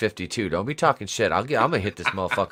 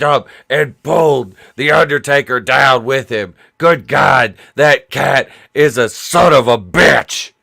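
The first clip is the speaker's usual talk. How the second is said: loud, hammed-up ringside outrage; wrestling commentator; 3 semitones higher, 8 dB louder than usual. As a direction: build it like an aghast announcer. Mock wrestling commentary on cat.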